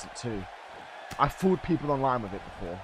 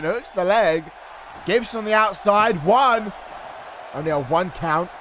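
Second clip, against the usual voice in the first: loud, higher, hyped commentary; play-by-play; 5 semitones higher, 3 dB louder than usual. Acted. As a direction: call the match like a ringside announcer. Live wrestling play-by-play.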